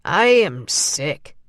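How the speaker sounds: simple stubborn reply; Lawrence's voice. A narrator's reading. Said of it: "Lawrence said".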